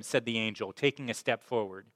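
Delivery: normal talking voice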